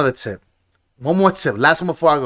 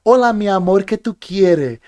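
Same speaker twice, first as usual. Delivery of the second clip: Spanish-speaking waitress; 4 semitones higher than usual. Retold or imitated. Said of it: "Like real, like, like Spanish".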